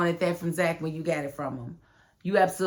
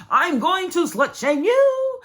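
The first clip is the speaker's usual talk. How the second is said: laughing; 11 semitones higher, 6 dB louder, slower than usual. Real, not acted.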